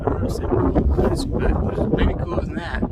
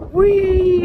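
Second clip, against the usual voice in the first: high, loud gleeful squeal; playful whee; 8 dB louder than usual. Pretend. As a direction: squeal it like on a ride. Roller coaster joke, squeal.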